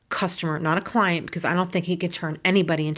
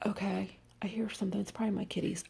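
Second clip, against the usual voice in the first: much softer, breathy whisper; real whisper; 12 dB quieter, faster than usual. Real, not acted. Whispering to viewers about a noise from her kitties. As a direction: drop to a hush to listen.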